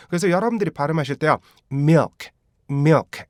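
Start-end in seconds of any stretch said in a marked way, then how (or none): 1.7-3.2 milk run together as one syllable, short vowel, clear final k. Shown as a demonstration. Teacher models how milk is pronounced, joined-up pronunciation. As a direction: say "milk" as a quick "myuk" with a crisp k. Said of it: "이렇게 발음하셔야 되고요".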